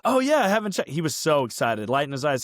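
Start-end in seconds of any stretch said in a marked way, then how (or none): 0.1-0.9 eager friend. Retold or imitated. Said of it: "He goes".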